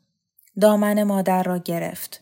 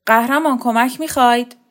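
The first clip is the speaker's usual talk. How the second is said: louder, higher shout from the yard; booming call; 4 semitones higher, 6 dB louder than usual. A narrator's reading. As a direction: boom it so the glass rattles. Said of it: "از طنین صدا شیشه لرزید".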